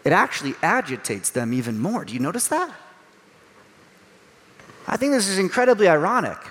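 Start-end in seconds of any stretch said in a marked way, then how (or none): none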